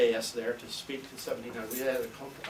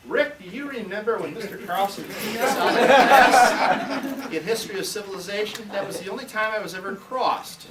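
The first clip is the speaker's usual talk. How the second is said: higher and louder, slowed, laughing through it; amused recollection; 8 semitones higher, 11 dB louder than usual. Retold or imitated. Hillary laughingly reminds her classmate about being kicked out. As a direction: tease an old classmate with a laugh.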